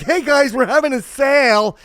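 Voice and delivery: high, loud mock sales pitch; hype salesman. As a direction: shout it like a phony store announcement.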